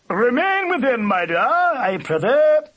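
loud, high-pitched, stately call; cultured old-fashioned badger. A narrator's reading. Badger calling down the hole to mate, cultured boom. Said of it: "tones were deep and cultured".

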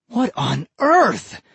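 high, slow stunned exclamation; a neighbor shocked at the wrecked garage; shocked cry. Acted. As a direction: gasp it out at the mess. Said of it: "he cried".

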